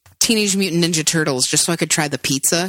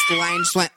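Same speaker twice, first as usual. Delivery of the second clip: turtle-like sound. Acted. Turtle sex joke, lion sound.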